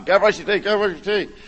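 put-on calling out to kids. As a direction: chivvy the kids along from a distance. Calling kids while loading car.

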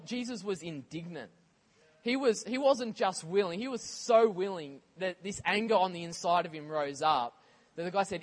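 usual speaking voice

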